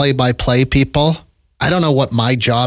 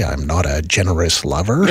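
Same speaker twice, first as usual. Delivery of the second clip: ordinary talk, nothing marked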